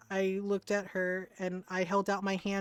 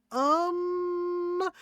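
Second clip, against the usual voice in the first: stunned um; 10 semitones higher, 6 dB louder than usual. Retold or imitated. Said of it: "And I went".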